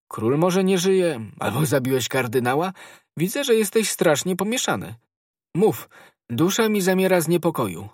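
high, loud, urgent questioning; agitated anxiety. A narrator's reading. Musketeer to his confused friend, agitated and anxious. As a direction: press for an answer in alarm.

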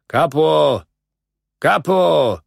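high acted call from a foreman; shouted summons. A narrator's reading. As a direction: call out sharply for the kapo. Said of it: "krzyknął do mnie".

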